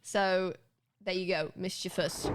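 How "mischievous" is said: "mischievous" as MIS-chi-vus; three syllables in "mischievous", stress on the first; correct pronunciation. Shown as a demonstration. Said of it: "just this one".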